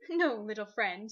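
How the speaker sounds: low, slow and kindly; a great lion soothing a small bird; lion's gentle voice. A narrator's reading. Aslan gently answering the jackdaw, low and slow.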